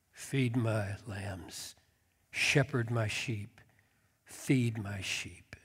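soft, slow, sinking to a whisper; hushed charge. Acted. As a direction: lay the charge down quietly and slowly. Jesus' solemn threefold charge.

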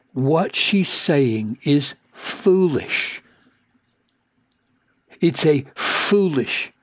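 normal talking voice

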